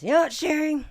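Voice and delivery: loud, high imitation of a friend; imitated calling out. Friend on the couch interrupts her complaining, calling out. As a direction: call out her name as the friend did.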